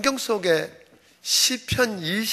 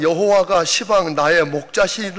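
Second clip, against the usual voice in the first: 여호와가 시방 나의 목자시니 read louder in Jeolla dialect; 5 dB louder than usual. Pretend. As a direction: say it like a Jeolla countryman. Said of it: "전라도 버전은".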